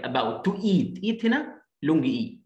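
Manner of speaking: ordinary delivery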